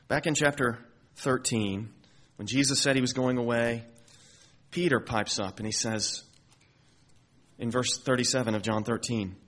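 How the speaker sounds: normal talking voice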